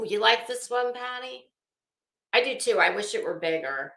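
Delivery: ordinary talk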